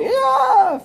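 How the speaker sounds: mock agony yell; imitated yell. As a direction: let out a short shrill scream. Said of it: "and yelling".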